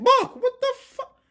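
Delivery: very high, loud disbelief; stunned outburst. Real, not acted. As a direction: squeal in amazement at the play. Stunned by a reverse pivot.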